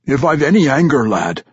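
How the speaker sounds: loud, high gruff dwarf scoff; gruff old dwarf warrior. Acted. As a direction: scoff like a crusty old veteran. Old warrior Ragged Dane voiced.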